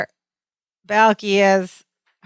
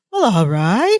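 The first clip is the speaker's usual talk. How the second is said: put-on Laurel accent. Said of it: "in Laurel's accent".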